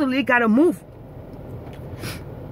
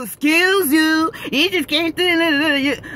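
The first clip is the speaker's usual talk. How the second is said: slow mock put-down; slower than usual. Mocking rude stranger's reply.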